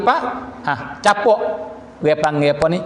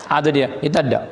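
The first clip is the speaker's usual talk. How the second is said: usual speaking voice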